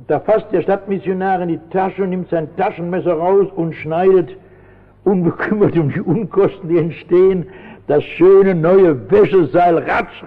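usual speaking voice

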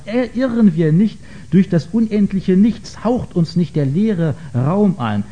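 heightened, rising questions of a despairing madman; dramatic reading. A narrator's reading. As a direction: read it like the madman crying to the crowd. Reads Nietzsche's madman passage aloud. (dramatische Lesung, erschüttert und eindringlich)

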